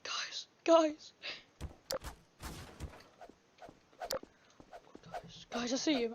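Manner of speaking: urgent whisper to the group; hushed whisper. Pretend. Warning the guys while hiding from Slush, hushed whisper. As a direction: whisper so he won't hear.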